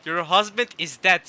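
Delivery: loud, slow, emphatic repeat; blunt loud retort. Real, not acted. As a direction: spell it out loudly and slowly. Repeats retort to game character.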